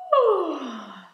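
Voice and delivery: big yawn sliding into the words. A narrator's reading. Sleepy Groot agreeing to tomorrow's plan, yawning. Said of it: "antwoordde Groot met een gaap".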